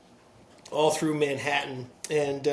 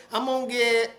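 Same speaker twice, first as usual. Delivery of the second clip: put-on Alabama accent; 5 semitones higher, 5 dB louder than usual. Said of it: "this crew from Alabama".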